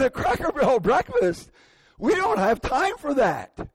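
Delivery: high, incredulous mock outburst; impatient outburst. Husband balking at wife's breakfast idea, impatient mock outburst. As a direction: throw the suggestion back in comic disbelief. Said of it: "I was impatient".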